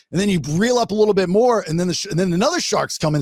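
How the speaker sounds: usual speaking voice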